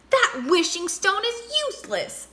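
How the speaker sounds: a hint of a cross monkey; mild angry shout. A narrator's reading. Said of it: "shouted Monkey".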